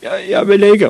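loud, high-pitched panicked cry; terrified high yelp of refusal; frightened shout. A narrator's reading. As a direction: stammer and yell it like a scared grown-up. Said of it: "råbte gymnastiklæreren".

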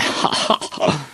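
high jeering cackle; acted laugh of teasing children; mocking laughter. A narrator's reading. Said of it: "og grinede".

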